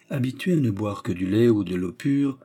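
usual speaking voice

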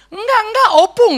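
put-on small child denying. Toddler denies breaking the vase to grandma, in a high childish voice. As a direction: play a little girl blaming her grandma.

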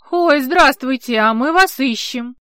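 loud friendly greeting; calling out. Acted. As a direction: call out to strangers. Nikolai calls out greeting.

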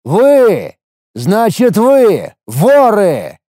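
high, very slow, loud accusation; accusing shout. A narrator's reading. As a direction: spit out the accusation. He accuses the thieves.

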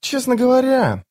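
high-pitched female scout; put-on high female voice; young woman's voice. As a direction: answer in a high, light girl's voice. Scout answers the sergeant.

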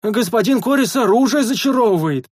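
eager announcement. A narrator's reading. The first sentry eagerly announces the news aloud. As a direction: shout the news to everyone. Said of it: "охотно поведал первый часовой во всеуслышание".

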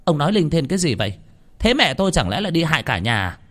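high, indignant retort; aunt cutting in on the shaman. Acted. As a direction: snap back at the shaman.

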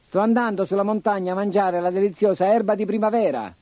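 harsh-voiced goat. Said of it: "voce aspra".

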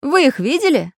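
high, loud pressing question; probing. A narrator's reading. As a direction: demand to know. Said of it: "допытывался Самоделкин".

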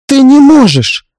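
high, loud, drawn-out cry; pleading. Acted. Mother-in-law begging husband to stop, desperate cry. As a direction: plead slowly at the top of the range. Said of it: "Взмолилась она".

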